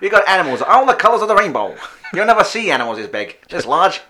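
high, loud shady showman; sketchy circus barker. Acted. Rizzo brother luring punters to the circus, sketchy barker voice. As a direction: holler it like a dodgy ringmaster. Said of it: "why they've got that accent".

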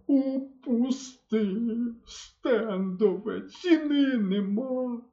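sobbing. A narrator's reading. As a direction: wail over the lost gun. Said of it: "проридав". (мисливець ридає за рушницею)

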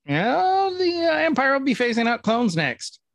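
high, drawn-out mock reply; mock character quote. Host retelling the clone's reply, in a high put-on voice. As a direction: stretch the opening word way up high, then carry on high. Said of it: "He's like".

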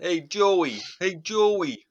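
high-pitched New York street call; New Yorker accent. Acted. Podcaster imitates New Yorkers hailing Joey, put-on New York accent. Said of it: "like, New Yorkers".

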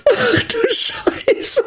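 high, drawn-out shocked cry; slow, stretched, amazed gasp of words; shocked exclamation. Real, not acted. Reacting to the coffee mishap.

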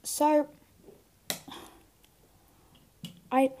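choking on a swollen tonsil. Real, not acted. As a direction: choke a little mid-word. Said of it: "me choking on my tonsil".

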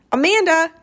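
loud, high call after her. Acted. Boy shouting after the girl running to school. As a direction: shout her name across the street.

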